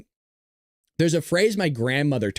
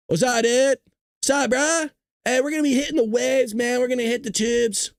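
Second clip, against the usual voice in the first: higher-pitched, hammed-up SoCal surfer-dude accent; Southern California surfer; 7 semitones higher than usual. Surfer telling a buddy they'll hit the waves, California surfer accent. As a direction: play up the California beach-bro accent. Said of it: "I'm an all California Southern boy".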